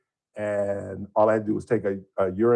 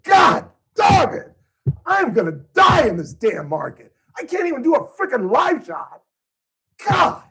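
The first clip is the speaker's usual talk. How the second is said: self-directed rant, pitched way up and shouted; reenacted angry outburst; 5 dB louder than usual. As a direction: blow up in frustration after botching the live shot. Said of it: "Just like that".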